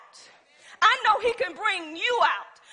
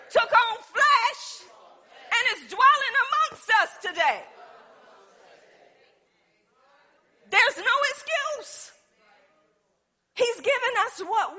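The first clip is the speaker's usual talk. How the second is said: high, loud preacher's cry; full-force preaching; 7 semitones higher, 5 dB louder than usual. Acted. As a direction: lift the church with a preacher's shout.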